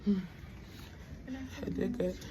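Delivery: weak, drowsy just-woke-up voice; barely awake after surgery; groggy post-anesthesia. Real, not acted.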